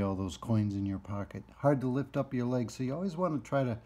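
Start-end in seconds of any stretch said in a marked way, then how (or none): none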